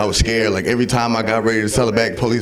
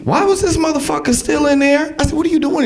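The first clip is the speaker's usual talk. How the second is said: much higher, stretched outrage; shocked high. Retold or imitated. Comic catching tall friend lingering in bathroom, shocked high. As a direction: act baffled, pitch way up.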